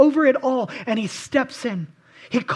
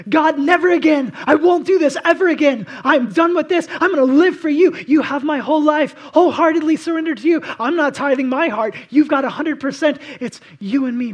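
loud, high, fervent promise to God; emphatic vow; 4 semitones higher, 8 dB louder than usual. Retold or imitated. Believer vowing to God, loud and fervent. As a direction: pledge everything, loudly.

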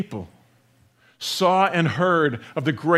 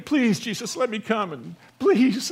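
higher-pitched mock pleading; begging; 5 semitones higher than usual. Healed man to Jesus, asking to come, acted-out begging. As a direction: plead like an eager tag-along. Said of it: "He's begging".

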